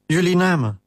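a petty soldier snapping out an order; barked. A narrator's reading. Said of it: "blafte hij". (hoger, kort geblaft)

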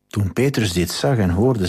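normal talking voice

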